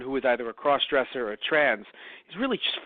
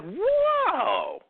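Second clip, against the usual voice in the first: high, startled whoa; hammed-up startled gasp; shocked whoa. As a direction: react as if scandalised. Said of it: "I'm like".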